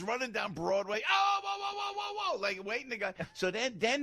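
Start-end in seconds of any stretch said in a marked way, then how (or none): 1.1-2.4 very high-pitched imitated holler after a car; mock frantic calling. Sebelia running down Broadway after the car, mock frantic calling. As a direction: imitate someone yelling for a driver to stop.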